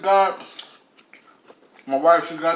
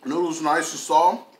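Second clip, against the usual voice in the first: talking while chewing macaroni; eating between words; tags: mouth full, chewing; 2 semitones lower, 6 dB louder than usual. Real, not acted. Seen 1: Forkfuls of macaroni go in mouth; jaw chewing through the words.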